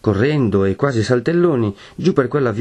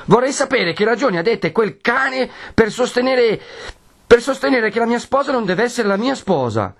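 much higher, strained furious cry; bitter young man raising his voice at the friar; high outraged protest through gritted teeth; angry shout. A narrator's reading. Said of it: "gridò digrignando i denti e alzando la voce".

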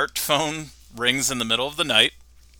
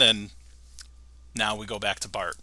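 normal talking voice

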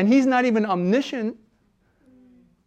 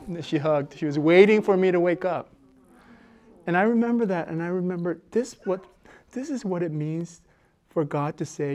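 ordinary talk

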